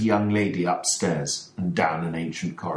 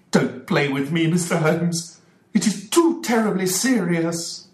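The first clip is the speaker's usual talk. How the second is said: high, loud, rushing plea; trembling desperation; 12 semitones higher, 4 dB louder than usual. Acted. Ferguson pleading with Holmes for his family, frantic and trembling. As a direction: beg him not to toy with you. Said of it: "Mister Ferguson was trembling all over".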